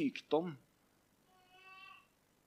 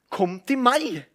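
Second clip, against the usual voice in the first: loud, high call; Jesus crying out; 10 semitones higher, 8 dB louder than usual. Acted. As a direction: cry it out over the praying crowd. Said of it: "reiser Jesus seg og roper". (Jesus roper høyt til folket)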